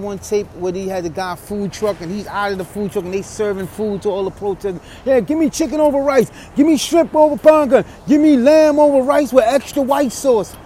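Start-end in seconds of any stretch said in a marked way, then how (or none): 5.1-10.5 louder, higher mock customer calling out orders; mock protester order. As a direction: rattle off the orders like a pushy customer. Mimicking protesters ordering food.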